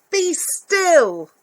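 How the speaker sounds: high, loud sharp command; commanding shout. Acted. Max shouting at the wild things to be still. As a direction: bellow it to freeze the monsters.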